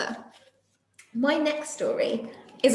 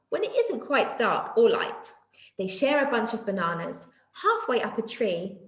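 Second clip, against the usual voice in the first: lively storybook reading voice; reading to children; 4 semitones higher than usual. A narrator's reading. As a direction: read it out like a picture book for little ones. Reading a picture book aloud to children.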